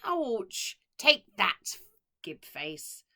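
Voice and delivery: loud yelp then jeering shout; yelp, then gloat cruelly; cry and jeer. A narrator's reading. Matron pokes orphan's eye.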